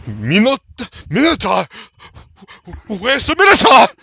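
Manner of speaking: nervously stutter, rising to alarm; nervous halfling. Acted. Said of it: "he starts nervously".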